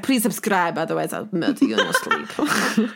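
put-on low, laughing Russian-accented menace; hammy Russian villain threat; very bad Russian accent. Podcaster jokingly threatening listeners to subscribe, bad Russian accent. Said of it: "a very bad Russian accent".

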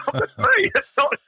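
high-pitched, laughing through the words; real laughing. Real, not acted. Lone magazine founder recalling being called a multinational, laughing. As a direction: break up laughing mid-story.

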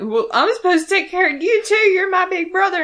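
high, loud, tearful wail; hammed-up crying little brother pleading; mock tearful cry. Little brother crying out to his big brother, high and tearful. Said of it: "Sam automatically starts crying".

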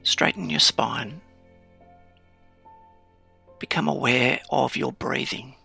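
calm grounding exercise. Acted. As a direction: settle the listener into stillness, unhurried. Guided grounding meditation, eyes closed.

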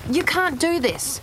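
high, slow cry of outrage; stretched, high-pitched protest; narrated scream of protest. A narrator's reading. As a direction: cry out high, holding back the volume. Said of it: "she screamed".

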